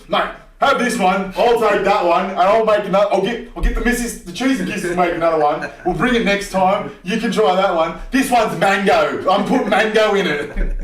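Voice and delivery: loud high-pitched Aussie bloke voice; gym old blokes. Retold or imitated. Mocking the old cobbers in the sauna, loud high bloke voice. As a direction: ham up a matey old cobber offering hot sauce. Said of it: "they were, like, trading hot sauce".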